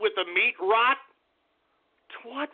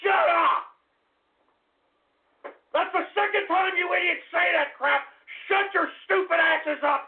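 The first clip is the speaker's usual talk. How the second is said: higher, shouted furious rant; angry shouting; 7 semitones higher than usual. Real, not acted. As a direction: yell at the chat in fury. Angry at chat joke.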